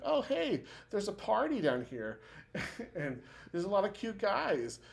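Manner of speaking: put-on light, high-pitched party girl gushing; Val's voice. Friend retelling Val spotting the party, high and gushing. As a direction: squeal it like an excited girl spotting cute guys.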